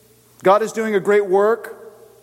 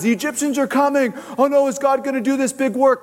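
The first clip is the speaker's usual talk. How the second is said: higher-pitched panicky cry; mock freaking out; 8 semitones higher than usual. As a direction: fret like a panicking crowd, higher. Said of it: "instead of freaking out".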